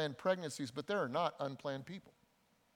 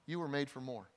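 usual speaking voice

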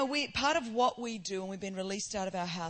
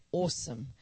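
"Awesome." said low and even, a proper Australian ossum; Queen's English "Awesome." with a refined, level fall; dignified Queen's English; 5 semitones lower than usual. Shown as a demonstration. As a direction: keep "Awesome." measured and dignified. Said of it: "This is the Queen's English".

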